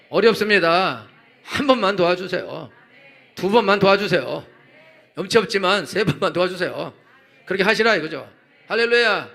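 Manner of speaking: high, forceful preacher's cry; impassioned preaching. Real, not acted. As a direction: preach it fervently, pressing each phrase home.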